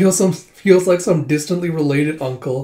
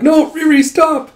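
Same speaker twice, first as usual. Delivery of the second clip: high, loud desperate cry to stop; much higher frantic call; panicked plea; 13 semitones higher, 8 dB louder than usual. Retold or imitated. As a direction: yell at her friend to halt. Friend grabbing her to stop her.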